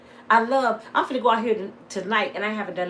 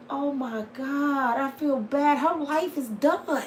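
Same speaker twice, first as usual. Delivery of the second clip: high, slow mock pity ending in a loud bright goodbye; 6 semitones higher, 5 dB louder than usual. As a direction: pretend sorrow, then wave off gaily. Kids pity, then cheerful goodbye.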